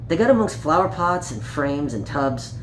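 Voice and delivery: put-on reading a picture book aloud to children; gentle storytime read, page by page; story narration. A narrator's reading. Storyteller reading Peter Rabbit to young listeners, gently.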